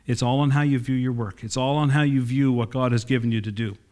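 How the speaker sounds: ordinary talk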